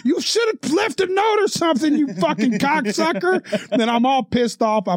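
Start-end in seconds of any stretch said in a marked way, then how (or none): 0.1-3.4 high-pitched retold cursing; indignant mock holler; angry heckling. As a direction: yell abuse back over your shoulder in mock fury. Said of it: "flipping off the construction crew behind me going".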